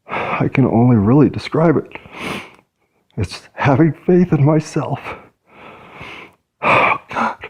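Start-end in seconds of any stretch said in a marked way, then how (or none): 3.2-5.0 rising into tears, choked up; voice starting to break. Real, not acted. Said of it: "I'm crying 'cause I'm overwhelmed". 6.6-7.4 breathy whisper as tears come; catching breath on the verge of crying; tearful whispered oh God. Real, not acted. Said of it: "I'm crying 'cause I'm overwhelmed".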